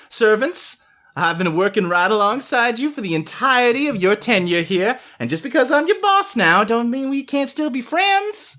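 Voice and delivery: rally the servants like a cheery new boss; new head butler. Acted.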